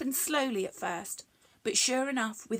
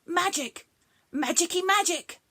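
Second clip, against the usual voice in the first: excited thought; 8 semitones higher, 5 dB louder than usual. Acted. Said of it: "he thought".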